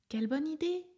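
put-on a young elephant spotting fun; little elephant voice. A narrator's reading. Little elephant hearing monkeys, high and lightly delighted.

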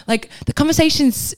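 ordinary talk, nothing marked

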